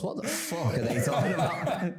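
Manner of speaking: high, slow mock baffled viewer, laughing; confused listener. Viewers baffled by the accents, high laughing mock voice. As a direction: play a lost listener while laughing. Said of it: "Everyone goes".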